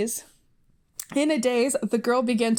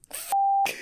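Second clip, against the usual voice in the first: embarrassed squeal. Real, not acted. Mortified outburst at own writing.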